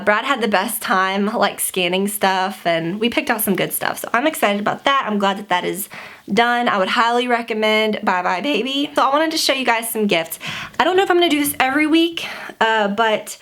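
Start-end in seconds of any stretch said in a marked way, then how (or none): none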